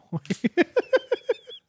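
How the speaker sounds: high-pitched rising giggle; real amused laugh. Real, not acted. Laughing at own offer.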